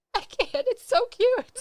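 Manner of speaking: choking up and barely getting words out; real teary choke. Real, not acted. Medium overcome with tears at the husband's love, crying. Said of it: "Stop being such a baby".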